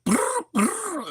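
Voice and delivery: high, exaggerated tongue trill; imitated rolled R. Showing how the agent would roll, high trill. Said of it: "British accent where she would roll".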